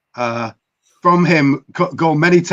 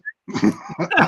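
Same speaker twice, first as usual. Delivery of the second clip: laugh; 8 semitones higher, 4 dB quieter than usual. Real, not acted. Laughs after accent bit.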